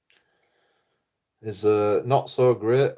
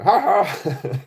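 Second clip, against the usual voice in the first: a mocking 'ha ha' laugh, higher and louder; 7 semitones higher, 5 dB louder than usual. Vlogger imitating the troll's gloating laugh, higher and louder. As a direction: cackle like the troll laughing at his own joke. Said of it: "laughing, and he's like".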